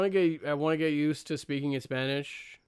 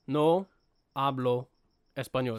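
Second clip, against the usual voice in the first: "No" "hablo" "español" each set apart by pauses; word by word. Shown as a demonstration. Correcting his friend by counting out each word. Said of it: "That's three words".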